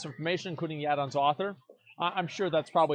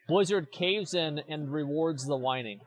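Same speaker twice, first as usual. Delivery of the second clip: usual speaking voice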